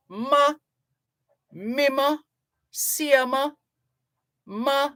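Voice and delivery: high-pitched Jamaican exclamation, drawn out; mother's exclamation. Retold or imitated. Host imitating his mother's Jamaican exclamation, high-pitched. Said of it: "I would hear my mother exclaim".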